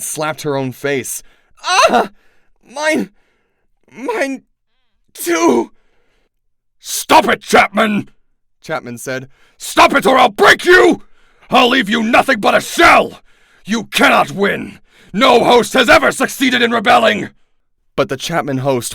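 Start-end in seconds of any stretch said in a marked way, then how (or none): 1.6-5.7 very high, alarmed outcry; panicked cries. Acted. Miss Chapman cries out as her host rebels, panicked. 6.8-17.3 much higher, louder raging yell; furious shout. Acted. Yeerk screams at the rebelling Chapman host, furious.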